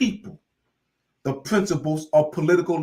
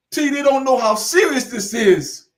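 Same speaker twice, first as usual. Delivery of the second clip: ordinary talk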